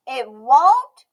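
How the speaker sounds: put-on high, bright little girl voice, loud and proud; a stubborn little girl declaring herself; Emmy's child voice. A narrator's reading. Emmy dismayed.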